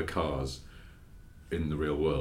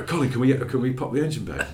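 eager; 5 semitones higher, 4 dB louder than usual. Retold or imitated. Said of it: "and they go".

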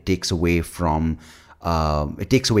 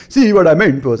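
louder, higher mock presenter spiel; 10 semitones higher, 9 dB louder than usual. Presenter leaning on PowerPoint as a crutch, mock-presenting loudly. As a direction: play a bad presenter leaning on the slides. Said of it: "you're like".

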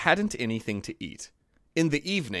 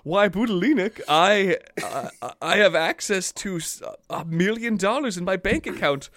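mock scammer; 6 semitones higher than usual. Prince scam voice.

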